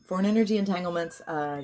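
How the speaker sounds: usual speaking voice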